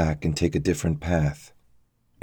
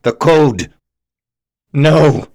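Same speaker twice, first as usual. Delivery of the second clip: higher, louder furious shouts; building rage; 9 semitones higher, 10 dB louder, much slower than usual. A narrator's reading. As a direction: explode with rage at him. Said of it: "His rage visibly building".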